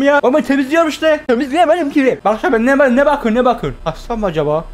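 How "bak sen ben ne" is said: ordinary talk, nothing marked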